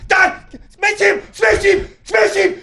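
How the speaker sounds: high, loud repeated shouting; yelling sideline dad. Retold or imitated. Crazy dad yelling at his kid from the sideline, high loud shout. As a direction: bellow it like a furious rugby dad.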